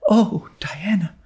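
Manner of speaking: airy unvoiced whisper of awe; hushed breathless whisper to a friend; breathed whisper. A narrator's reading. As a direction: breathe it out in wonder, barely voiced. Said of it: "breathed Anne".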